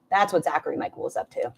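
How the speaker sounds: ordinary talk, nothing marked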